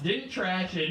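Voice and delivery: ordinary talk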